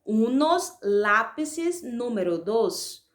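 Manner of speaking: unos lápices número dos said slowly and carefully, word by word; slow dictation. Shown as a demonstration. Teacher reading the answer for students, slow and clear. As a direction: dictate the phrase slowly so pupils can write it. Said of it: "Então, ficaria".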